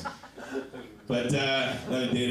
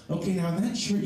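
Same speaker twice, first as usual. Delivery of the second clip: higher, lightly imitated girlfriend; mock girlfriend voice; 9 semitones higher than usual. Girlfriend judging his shirt, lightly mocked high voice. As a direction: lift it into her critical voice. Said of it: "She goes".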